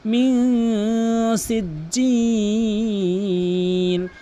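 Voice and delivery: মিন সিজ্জিল with the n hidden in a long nasal hum; long-drawn nasal মিন সিজ্জিল; ikhfa ghunnah. Shown as a demonstration. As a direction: slowly chant মিন সিজ্জিল, holding the nasal. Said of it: "মিন বলতে হবে".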